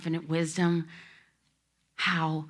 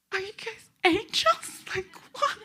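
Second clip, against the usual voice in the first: awed disbelief. Retold or imitated. Asking the praying strangers if they are angels, in tearful wonder. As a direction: ask it half laughing, half crying in awe. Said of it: "I'm like".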